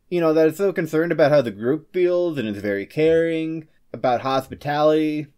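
ordinary talk

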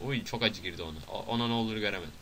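ordinary delivery

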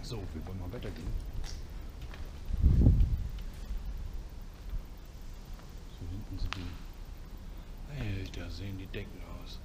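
hushed, tense whisper while exploring; nervous night vlog whisper. Real, not acted. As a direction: keep it whispered, wary of noise. Quiet exploring in derelict building.

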